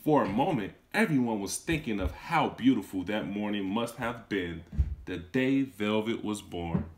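gentle read-aloud storytelling; storybook narrator voice; story narration. A narrator's reading. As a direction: read it to a class of children. Teacher reads story aloud.

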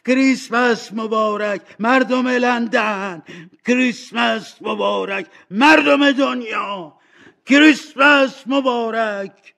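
high, loud, drawn-out festive cries; joyful shouting. Acted. Scrooge shouting Christmas greetings to the people of London. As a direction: call it out to the whole world. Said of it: "فریاد زد".

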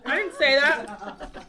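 ordinary talk, nothing marked

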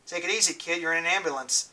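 soft calm reassurance; reassuring. Acted. Man soothing the hurt boy in the ambulance, soft and calm. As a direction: ease the kid down gently.

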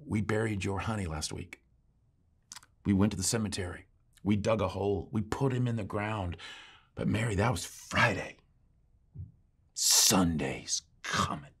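soft, hushed preacher to a widow; tender consolation. Retold or imitated. Preacher gently addresses a bereaved woman.

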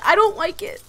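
upset and a bit high, "don't" stressed. Real, not acted. Player upset that Naya is hurt, leaning on "don't". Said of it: "I am so emotional".

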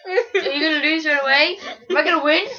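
laughing through full mouth. Real, not acted. Stuffed cheeks, laughing.

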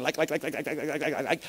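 rapid-fire mock stutter on "like"; mimicking nonstop filler words. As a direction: rattle it off like a machine gun, mocking. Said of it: "come into my office saying".

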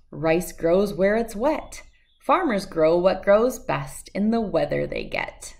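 plain reading voice

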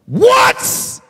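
high, loud shout of What's; shouted word. Acted. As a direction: fling What's out to the back row. Shouted question, then whispered repeat.

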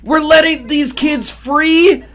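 high, loud, drawn-out disbelief; incredulous outburst. Pretend. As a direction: shout it as an outraged question. Mock outrage as children leave.

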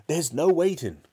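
high, loud and impatient; worked-up cry. A narrator's reading. Father working himself up at breakfast, loud excited cry. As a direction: cut in high and forceful. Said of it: "working himself up so much".

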